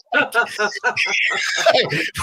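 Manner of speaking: big shrieking laugh. Real, not acted. Laughing hard at own joke.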